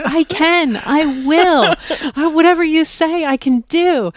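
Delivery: eager yes. Retold or imitated. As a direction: throw yourself into it, totally eager. Eager retold self reply.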